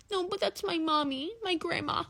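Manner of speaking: high, mocking kid voice defending mommy; mock whiny friend. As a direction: whine it like a spoiled child. Friend defending her family.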